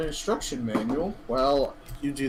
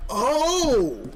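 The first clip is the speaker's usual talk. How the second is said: surprised; 10 semitones higher, 6 dB louder than usual. Real, not acted. Sudden reaction to the screen.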